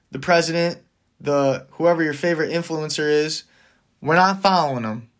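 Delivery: ordinary talk, nothing marked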